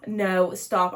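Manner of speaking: sharp gasp, then loud "no, stop"; excited gasp. Real, not acted. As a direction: gasp in disbelief. Overwhelmed by meeting author.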